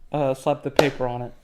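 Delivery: ordinary talk, nothing marked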